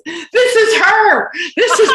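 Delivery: very high, loud and drawn out; mock-amazed cry, breaking into laughter; excited exclamation. Customer telling his friend about the waitress, excited exclamation. Retold customer pointing her out.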